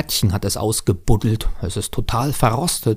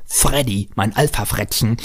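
ordinary delivery

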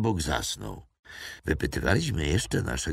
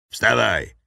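sharp, much louder command; barked order; 5 semitones higher, 9 dB louder than usual. A narrator's reading. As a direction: snap him to his feet. Captor orders prisoner up.

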